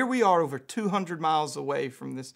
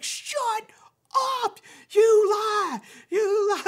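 high, drawn-out mock shriek, building louder; astonished disbelief; 8 dB louder, much slower than usual. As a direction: stretch each word in hammed-up amazement. Homeless man's retold mounting disbelief.

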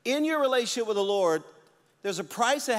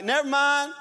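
higher, louder quick surrender; loud give-up call; 7 semitones higher, 6 dB louder than usual. Retold or imitated. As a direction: blurt it as the bag drops. Dropping the bag, giving up.

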